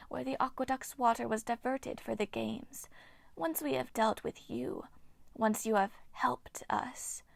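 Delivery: eerie breathy whisper of an ancient nymph; ghostly nymph whisper. Acted. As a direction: hiss it like a withered water spirit. Nymph Hagno speaks.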